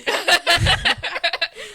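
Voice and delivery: loud burst of laughter. Real, not acted.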